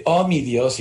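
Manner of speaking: overacted bad dub line, said with scorn; stilted dubbing cliché. Voice actor mocking the awkward phrases of bad dubbing, hammed-up. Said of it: "que suenan horrible y que echan a perder".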